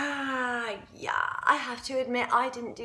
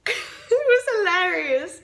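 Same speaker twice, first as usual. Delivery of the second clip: higher voice breaking into laughter; cracking up; 6 semitones higher, 3 dB louder than usual. Acted. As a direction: talk through a burst of laughter. Cracks up recalling the scene.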